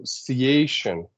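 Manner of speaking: "ciation" drawn out slowly, leaning on the A; stressed syllable. Shown as a demonstration. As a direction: hold "ciation" longer to show the stress. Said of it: "has a bit longer".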